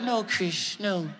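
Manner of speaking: [name] impression. Star banishing Chris to the car, upset. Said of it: "And Mike was like".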